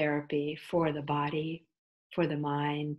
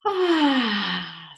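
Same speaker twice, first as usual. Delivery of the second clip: loud open sigh, starting high and sliding down; exaggerated sigh; 6 semitones higher, 9 dB louder than usual. Shown as a demonstration. Yoga teacher demonstrating an exaggerated exhale, big falling sigh. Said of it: "sigh it out".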